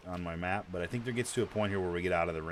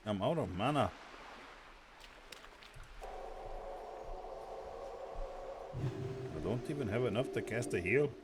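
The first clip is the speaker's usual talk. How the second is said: mock Irish lilt, badly done; terrible Irish accent; 3 semitones higher, 6 dB quieter than usual. Gamer out of mana jokes in a bad Irish accent. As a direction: ham up a fake Irish brogue. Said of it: "that's my terrible Irish accent".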